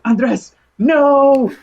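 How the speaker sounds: high, loud laughing protest; embarrassed, laughing protest at a compliment; embarrassed squeal. Retold or imitated. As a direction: squeal his name, flustered. Elise embarrassed by his praise.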